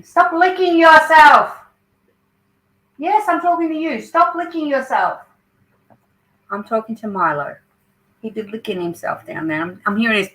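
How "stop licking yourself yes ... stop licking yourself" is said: scolding. Real, not acted. Scolding her dog Milo.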